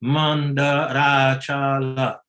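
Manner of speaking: "Mandarachala" slow and even, no syllable stressed; correct Sanskrit diction. Shown as a demonstration. Teacher modelling the correct word for the students. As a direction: say "Mandarachala" slowly, flowing, unaccented. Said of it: "That is the correct diction of Sanskrit".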